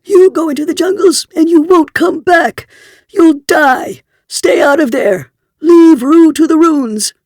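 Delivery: very high, loud, frantic warning cry; shrill screech. A narrator's reading. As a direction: screech it like a half-mad old prospector. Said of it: "A shrill voice screeched".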